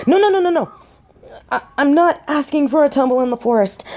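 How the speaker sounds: high, quick backpedalling; flustered protest. Acted. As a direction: hurry to correct a flirty misunderstanding. Flustered, fending off flirting.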